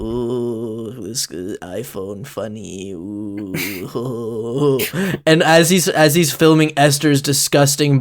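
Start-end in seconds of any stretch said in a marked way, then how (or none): 0.0-4.8 low, soft, slow alien croon; alien creature voice. Acted. The GM voicing ET filming the blob, low and slow. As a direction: purr it like a strange little alien.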